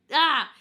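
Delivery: sudden high scream of pain; loud pained yell. Retold or imitated. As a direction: let out a raw cry as you bite down. Biting husband during contraction.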